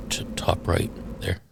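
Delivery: whisper. Real, not acted. Pointing out screen detail.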